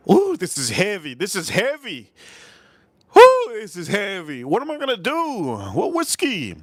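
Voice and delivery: high, swooping cries of amazement; whiskey-struck exclamation. Real, not acted. Streamer reacts to a heavy whiskey, high excited exclamation.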